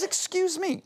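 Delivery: offended man. Retold or imitated. The accused man taking offense, high and indignant. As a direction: bristle with mock offense. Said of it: "well, he goes".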